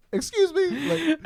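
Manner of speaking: high, slow, laughing mock offense; indignant excuse me. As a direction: pretend to be insulted, stretched out and laughing. Offended at needing a life vest.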